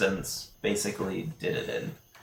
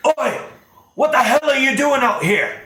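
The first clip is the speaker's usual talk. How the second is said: angry yell; 13 semitones higher, 6 dB louder than usual. Acted. Guard leans out and yells.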